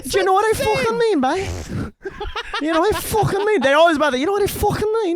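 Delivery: high, put-on Scouse; hammed-up high Scouse accent. Friend joining in the accent demo, high Scouse accent. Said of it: "Fucking Scouse accent is like this".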